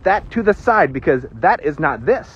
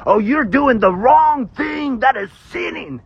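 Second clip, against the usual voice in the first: mock accuser; 6 semitones higher than usual. Preacher imitating legalists accusing others of sinning, mock scolding. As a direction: wail it like a self-righteous scold.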